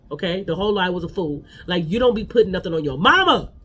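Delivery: normal talking voice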